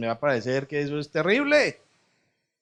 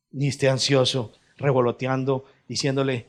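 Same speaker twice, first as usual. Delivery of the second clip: ordinary talk, nothing marked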